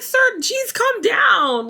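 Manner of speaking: high-pitched exasperated cry; exasperated outburst. Retold or imitated. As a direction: tell him off in a high, exasperated voice. Acts out telling him off.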